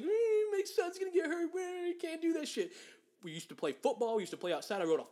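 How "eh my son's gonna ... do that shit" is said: higher, whiny mock mom voice; sneering imitation of an overprotective mom; mocking soccer mom. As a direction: mimic a fretting mother to ridicule her. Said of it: "soccer moms like".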